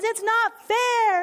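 high, slow whine of a sulking son; whining son. Retold or imitated. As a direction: drag it out like a hurt kid. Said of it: "He's whining and he's pouting".

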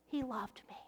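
whispering the retold words; whispered. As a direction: let it fall to a whisper.

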